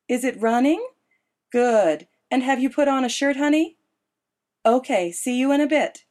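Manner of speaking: Esme on the phone. A narrator's reading. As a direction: coax her son over the phone.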